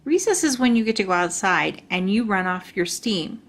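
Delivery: plain reading voice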